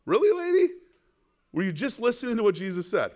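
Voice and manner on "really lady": much higher mock disbelief; raised, exasperated "really, lady"; incredulous. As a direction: scold her like she can't be serious. Preacher scolds the mother in mock disbelief.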